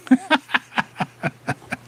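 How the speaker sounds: soft, high-pitched laugh. Real, not acted. Host fumbling his own arithmetic, soft high laugh. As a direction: laugh softly at your own muddle.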